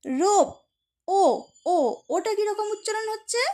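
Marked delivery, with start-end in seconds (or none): 0.0-1.2 রোপ with a full, held "oh"; long O sound. Shown as a demonstration. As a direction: make the ও in রোপ the letter's own name. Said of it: "ওর মতো করেই উচ্চারণ হচ্ছে". (রোপ-এর ও টেনে, নামের মতো)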